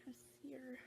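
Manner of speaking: soft whisper, barely voiced; whisper to self. Real, not acted. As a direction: whisper it to yourself. Muttering to herself while searching.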